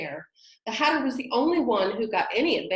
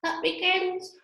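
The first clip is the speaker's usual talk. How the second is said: startled awake; 10 semitones higher, 4 dB louder than usual. Acted. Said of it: "woke up with a little shriek".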